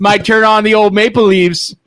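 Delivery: put-on Canadian accent. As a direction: sign off like a Toronto streamer. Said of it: "can you do the Canadian accent".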